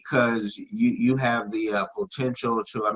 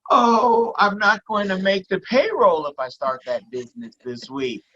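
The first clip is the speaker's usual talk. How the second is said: higher, fretful mock of a panicking boss; mock spoiled worrier; 6 semitones higher than usual. Mocking spoiled Americans who fear risk, fretful higher voice. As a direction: play the anxious spoiled business owner, tongue in cheek. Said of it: "most Americans are spoiled and have a negative".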